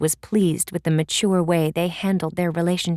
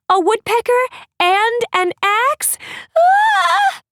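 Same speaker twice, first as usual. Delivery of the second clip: much higher, louder panicked shriek; disbelieving, building to a scream; a fleeing girl's terrified cry; 4 dB louder, slower than usual. Acted. Cedar panics running.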